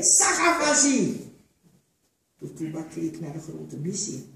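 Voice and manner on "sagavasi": loud, slow barked order; the overseer yells it down at the slave; shouted command. A narrator's reading. Overseer shouting at a slave, loud and slow. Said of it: "riep hij".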